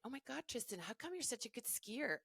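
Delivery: mock American teen gushing in an American accent; bad American accent. Juniors praising her son, in a lightly done American accent. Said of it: "my really worst American accent".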